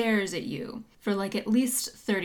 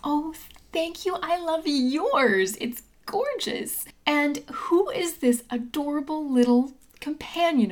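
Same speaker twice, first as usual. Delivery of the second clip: high, gushing gnome voice; delighted gnome; 6 semitones higher than usual. Acted. Gnome admiring visitor's companion, high and delighted. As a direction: gush over the compliment and the pet.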